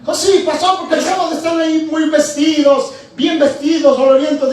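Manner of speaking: high, loud, laughing jeer; laughing mockery. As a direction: scoff through a laugh at the churchgoers. [name] mocks churchgoers, laughing. (burla entre risas, aguda y fuerte)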